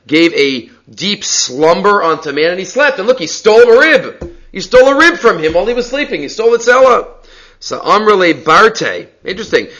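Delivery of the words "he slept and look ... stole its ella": much higher, indignant charge; mock accusation. Heretic accusing God of stealing the rib, much higher and indignant. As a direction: play the heretic crying thief.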